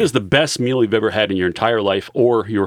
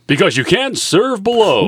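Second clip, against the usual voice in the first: hype tagline; 11 semitones higher, 3 dB louder than usual. Acted. Tagline after intro.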